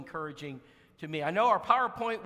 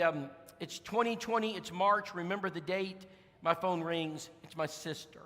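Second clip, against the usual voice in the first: normal talking voice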